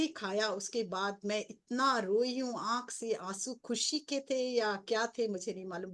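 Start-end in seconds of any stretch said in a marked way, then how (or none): none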